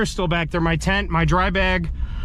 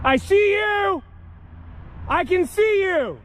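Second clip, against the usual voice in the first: high, slow shout into the woods; 12 semitones higher, slower than usual. Real, not acted. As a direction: yell out to warn them.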